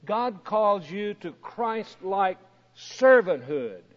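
ordinary delivery

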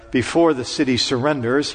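normal talking voice

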